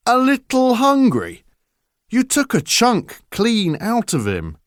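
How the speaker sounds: high, loud incredulous scolding; scolding father. Acted. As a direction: throw his words back at him.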